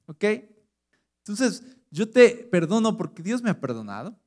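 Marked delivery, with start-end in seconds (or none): none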